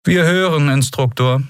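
high, measured voice of a commander; a hint of a clipped officer; cold interrogator. A narrator's reading. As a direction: speak down to the prisoner.

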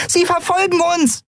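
very high, alarmed small voice; called out. A narrator's reading. Nelen warns the crew of pursuers, high cry. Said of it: "rief Nelen".

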